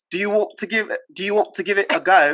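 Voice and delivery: American putting on a British accent; attempted British accent. Shown as a demonstration. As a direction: try the British accent for approval. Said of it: "Did I do it right?".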